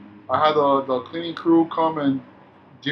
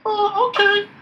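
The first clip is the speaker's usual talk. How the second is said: squeaky put-on persona, much higher; high-pitched persona voice; 3 dB louder, slower than usual. Night clerk goofing at the desk in a high-pitched persona voice. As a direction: pop up into a silly high voice to break up a coworker. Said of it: "his high-pitched voice".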